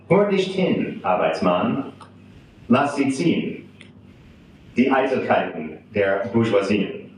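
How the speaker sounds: put-on German verse recited with an American accent; poem reading. Translator reads Tucholsky's German satire to an audience, measured poem reading. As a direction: recite in measured phrases, pausing between lines.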